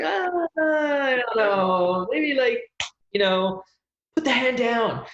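very high, drawn-out waver of doubt; mock hesitation. Acting out someone about to press send, comically torn. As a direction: squeal reluctantly, as if holding yourself back. Hesitating before sending an angry email.

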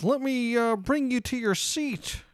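put-on high, slow Chicago accent; maître d' seating the guest, Chicago accent. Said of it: "this weird, like, Chicago accent".

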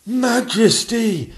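high, loud, drawn-out plea; bursting out. Acted. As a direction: blurt it in anguish. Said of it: "burst out the Lord Chamberlain".

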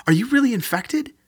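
panicked disbelief; horrified. Acted. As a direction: recoil in fear as you ask. Sophie horrified by Amanda.

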